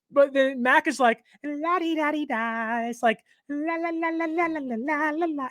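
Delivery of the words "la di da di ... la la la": high, soft carefree la-la; oblivious sing-song. Retold or imitated. Mocking Mac ignoring the pass rush, high soft sing-song. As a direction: hum along without a care in the world.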